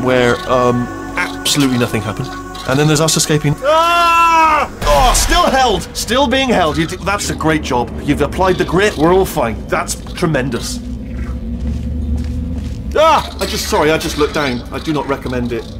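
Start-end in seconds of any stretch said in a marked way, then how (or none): 3.6-4.7 high, drawn-out yell of fright; sudden high scream; startled scream. Acted. Startled during escape. 4.8-6.8 alarmed. Acted. Alarm while being carried. 13.0-13.4 short, high yelp; sudden high cry of fright; frightened yelp. Acted. Looked down from a height.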